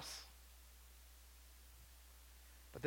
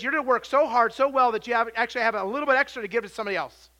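loud, high, driving preacher voice; full force preaching; 6 semitones higher, 12 dB louder, faster than usual. Acted. Preacher urging church to give, loud and high. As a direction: press it home to the congregation.

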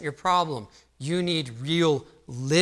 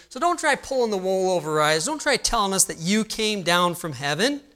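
high, loud, mocking voice of the doubting crowd; scoffing crowd; 5 semitones higher, 3 dB louder than usual. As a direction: mimic the smug know-it-all townsfolk. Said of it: "They say".

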